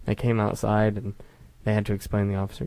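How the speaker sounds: ordinary delivery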